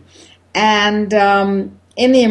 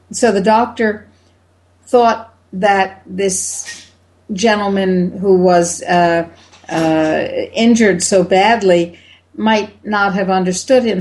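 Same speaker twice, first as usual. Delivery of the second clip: ordinary delivery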